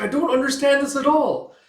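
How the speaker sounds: high, loud betrayed wail; acted desperate, high-pitched pleading; distraught protest. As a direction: cry out as if betrayed. Character panics at the betrayal.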